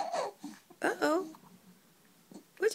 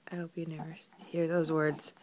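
whispering; 10 semitones lower, 6 dB quieter, faster than usual. Real, not acted. Whispered aside.

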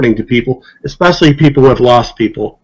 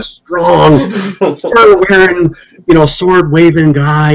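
mock Viking boast; 3 semitones higher, much slower than usual. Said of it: "he had a thing for the Vikings".

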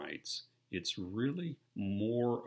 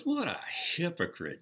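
scornful remark; 11 semitones higher, 3 dB louder than usual. Retold or imitated. Dave's retold scornful remark.

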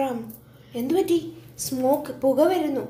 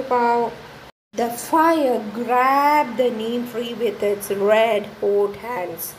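ordinary delivery, nothing marked

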